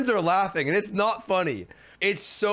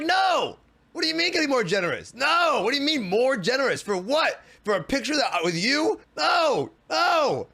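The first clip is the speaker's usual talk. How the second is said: indignant yelling; 4 semitones higher than usual. Retold or imitated. Refusing the street performer's demand for more money, yelling. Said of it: "I'm like".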